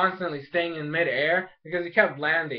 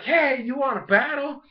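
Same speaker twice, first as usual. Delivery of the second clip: high challenging call; 6 semitones higher than usual. Retold or imitated. Lady's challenge, acted.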